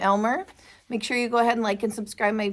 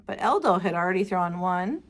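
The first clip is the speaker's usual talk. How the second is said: ordinary delivery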